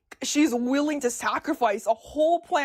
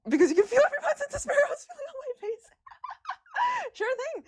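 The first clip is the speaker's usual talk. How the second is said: very high, fast, laughing through words; 14 semitones higher, faster than usual. Real, not acted. Streamer laughing about her despairing photo, high and fast. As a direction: crack up as you tell it.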